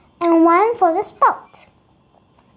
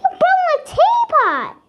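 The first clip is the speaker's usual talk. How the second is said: high, shrill-free furious shout, much higher; angry yell. A narrator's reading. Billy yelling at Jason over her smashed teapot. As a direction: yell it at the bully who broke it. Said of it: "she yells loudly".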